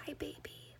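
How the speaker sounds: hushed whispered greeting; ASMR whisper. Retold or imitated. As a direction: breathe it like an ASMR girl. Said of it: "she's like".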